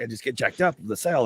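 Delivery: usual speaking voice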